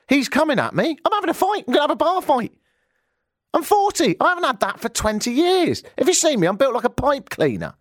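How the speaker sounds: high-pitched alarmed ranting; comic panic. Retold or imitated. Telling listeners a bar fight is coming, comic panic. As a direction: pitch it up in mock dread of a fight.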